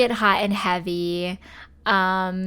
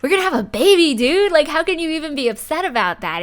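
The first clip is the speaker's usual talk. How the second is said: higher, louder delighted outburst; excited; 7 semitones higher, 7 dB louder, faster than usual. Pretend. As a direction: burst out with joy about the baby. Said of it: "he's like".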